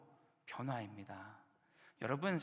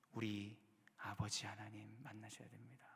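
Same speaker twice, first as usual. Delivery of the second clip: hushed, breathy whisper to the congregation; 5 semitones lower, 10 dB quieter than usual. Real, not acted. Preacher whispering to the congregation about the Father. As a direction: lean in and whisper it like a secret. Preacher drops voice mid-sermon.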